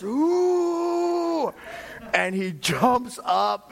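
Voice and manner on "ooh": straining grunt. Retold or imitated. Imitating the son's effort.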